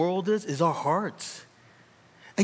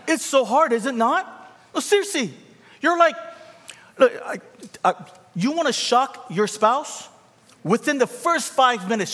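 pushes up high and loud; preaching at full force, voice climbing; rising preacher fervor; 8 semitones higher, 3 dB louder than usual. Real, not acted. Preacher urging the congregation, loud and high.

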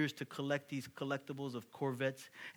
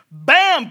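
shouted bam, much higher and louder; 23 dB louder than usual. Acted. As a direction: slam the word out like a blow. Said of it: "He probably didn't say it like that".

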